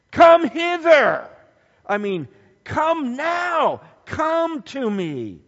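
high, loud, slow summons; commanding call. Shown as a demonstration. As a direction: call them in with full force. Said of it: "Come with an exclamation point".

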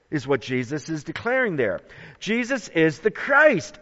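ordinary talk

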